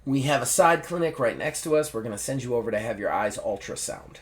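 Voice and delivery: deadpan, low-key doctor; understated stone-faced delivery. Retold or imitated. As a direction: deliver it evenly, matter-of-fact. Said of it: "like, stone-faced".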